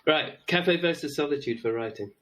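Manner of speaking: ordinary talk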